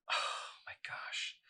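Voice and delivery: slow, breathless whisper of dismay. Acted. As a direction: whisper it as if caught out. Mandy finds no wallet.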